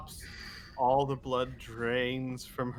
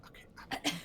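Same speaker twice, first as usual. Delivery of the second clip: faint, trailing whisper; barely audible; 3 semitones higher, 12 dB quieter, much faster than usual. Acted. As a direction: mumble it almost inaudibly. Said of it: "I, I can't hear you".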